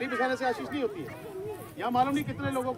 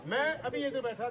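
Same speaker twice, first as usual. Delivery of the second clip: ordinary talk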